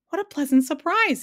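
high, loud, delighted greeting; happy cry. A narrator's reading. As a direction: exclaim happily at an unexpected guest. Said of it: "he cried happily".

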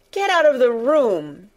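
high, loud furious order; angry shout. A narrator's reading. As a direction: shout them out of the room in a rage. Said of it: "he shouted".

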